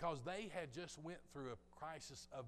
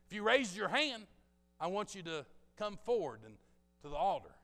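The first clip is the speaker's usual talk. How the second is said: loud, raised preacher voice; preacher's altar call; 6 semitones higher, 11 dB louder than usual. Retold or imitated. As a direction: call them forward like a preacher. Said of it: "just like any good preacher would say".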